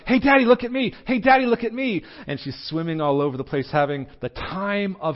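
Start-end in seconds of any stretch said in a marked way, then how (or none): 0.1-2.0 put-on high, loud little-girl call, much higher and louder; child yelling. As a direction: call out across the pool like an excited toddler. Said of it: "she's yelling".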